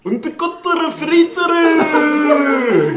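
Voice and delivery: mock Japanese-style names. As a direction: belt out the silly names like a stadium announcer. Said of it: "Wir heißen ja auch alle Franz. Für die Japaner".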